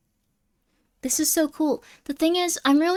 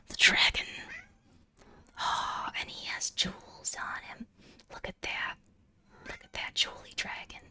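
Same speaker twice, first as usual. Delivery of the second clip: ASMR whisper; 8 dB quieter than usual. Acted. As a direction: hush it like an ASMR unboxing. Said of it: "I'm becoming A S M R".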